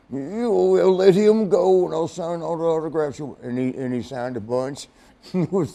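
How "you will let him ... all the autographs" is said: Randy Savage. Retold or imitated. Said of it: "He goes".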